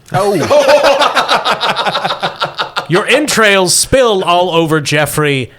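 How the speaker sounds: ordinary talk, nothing marked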